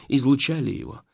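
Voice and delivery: ordinary delivery, nothing marked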